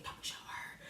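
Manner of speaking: hushed, voiceless whisper. Retold or imitated. Classmate in the back warns another not to help, whispering. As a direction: whisper it secretively from behind. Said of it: "like, whispering".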